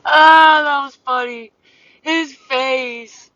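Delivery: high, loud, giggling through the words; laughing. Real, not acted. Recalling the dog's funny face, laughing high. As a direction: squeal it out amused.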